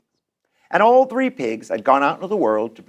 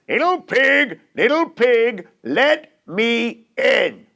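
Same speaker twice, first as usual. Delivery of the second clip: big booming wolf, higher and slow; great big wolf voice; 5 semitones higher, much slower than usual. Acted. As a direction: call out slowly like a big bad wolf. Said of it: "in a great big voice".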